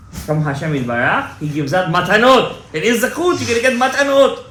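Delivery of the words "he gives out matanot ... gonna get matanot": rising, loud and eager; fervent preaching. Real, not acted. Rabbi urging his listeners about the gifts, fervently. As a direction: build it up like a promise of gifts.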